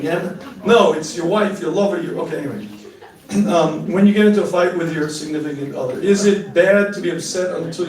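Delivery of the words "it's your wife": usual speaking voice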